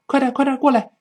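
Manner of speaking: high hurried urging; urging someone on. Acted. Hurrying someone to come over, urgent high call. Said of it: "催催对方".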